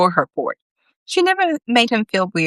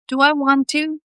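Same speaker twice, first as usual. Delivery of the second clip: high playful cat character; 10 semitones higher than usual. A narrator's reading. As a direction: voice the cat with a lift. Constantine the cat speaks.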